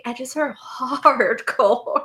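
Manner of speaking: laughing. Real, not acted. Laughs while retelling.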